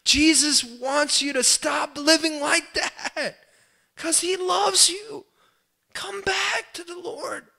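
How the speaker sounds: very high, slow, tearful pleading; desperate crying out. Acted. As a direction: beg them desperately, stretching each word out. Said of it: "desperately crying out to them".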